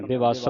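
ਬਿਵਸ as "bi-VASS" with addhak; weight on second syllable of ਬਿਵਸ; doubled s. Shown as a demonstration. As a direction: hold the final s of ਬਿਵਸ. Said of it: "ਅਧਿਕ ਲਾ ਕੇ".

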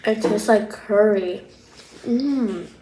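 talking while chewing; mouth full chewing. Real, not acted. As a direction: food in mouth. Seen 0: Cheeks puffed and jaw working after biting food at -2.

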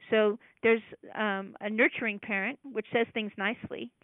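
normal talking voice

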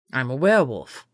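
low, casual admission; matter-of-fact. A narrator's reading. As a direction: say it like it's nothing. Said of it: "like it was no big deal".

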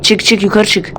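ordinary talk

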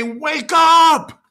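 high loud call; shouting. Shown as a demonstration. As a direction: call out to wake her. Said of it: "Everyone say".

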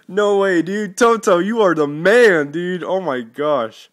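high, loud, overjoyed shouting; thrilled. Real, not acted. Thanking his friend Toto for the gift, shouting with joy. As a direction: yell your joy at the gift.

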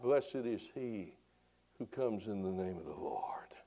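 preacher recalls the crowd's blessing in a whisper. Real, not acted. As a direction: drop to a reverent whisper. Preacher hushes the quoted cry.

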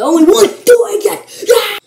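high, loud burst ending in a yell; loud exclamation. Real, not acted. Vlogger playing the game alone, loud exclamation. Mock cheer at being alone.

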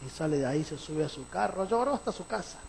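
ordinary talk

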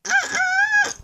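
extremely high, loud refusal; squeaky toy voice. Acted. Toy voice objects.

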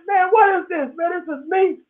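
very high, amazed squeal of disbelief; astonished. Retold or imitated. Retelling finding himself on TikTok, high astonished squeal. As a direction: squeak it out, stunned to find yourself online.

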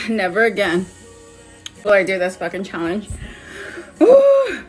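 loud, strained, mouth on fire; mouth burning from spicy food. Real, not acted. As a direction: push words out through the burn. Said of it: "This shit is so hot".

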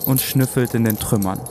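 calm, measured documentary narration; story narration. A narrator's reading. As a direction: tell it like a tense expedition tale. Dramatic intro narration.

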